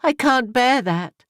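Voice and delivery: plead, unable to bear it; anguished cry. A narrator's reading. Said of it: "she cried in her anguish".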